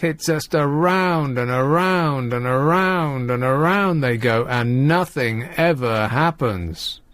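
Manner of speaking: slow, drawn-out droning; droning monotony. Acted. Host mocking motor racing as endless laps, slow drone. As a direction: stretch it out in a rolling, circling drone.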